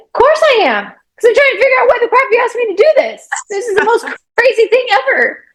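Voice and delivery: high, fast, exasperated reply to God; exasperated retort. Retold or imitated. Answering God on the drive, high and exasperated. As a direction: sputter back in disbelief. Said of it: "And I was like".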